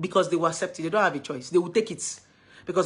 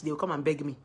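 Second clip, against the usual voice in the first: usual speaking voice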